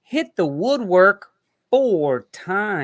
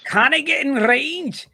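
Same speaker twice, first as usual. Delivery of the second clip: high-pitched put-on Scottish accent; mock Scottish accent; 9 semitones higher than usual. Host spoofing a Scottish player seeking a shot, mock Scottish accent. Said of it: "Who does Shrek's voice?".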